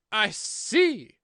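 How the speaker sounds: loud, high interruption; cutting queen. A narrator's reading. Queen interrupting the gardener, loud and imperious. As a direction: break in loudly and imperiously.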